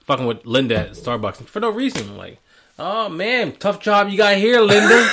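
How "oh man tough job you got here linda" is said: higher, louder fake sympathy; mock sarcastic. Coworker to Linda at Starbucks, imitated sarcasm. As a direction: imitate him needling with put-on pity. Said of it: "he was so sarcastic".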